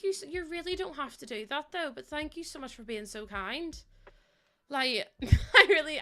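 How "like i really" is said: laughing. Real, not acted. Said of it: "You're gonna make me cry".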